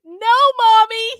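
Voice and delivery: high, loud pained howl. Acted. James howls in pain under the whip. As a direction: howl like a hurt child. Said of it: "he howled in pain".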